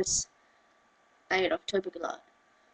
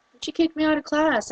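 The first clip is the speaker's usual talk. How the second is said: ordinary talk, nothing marked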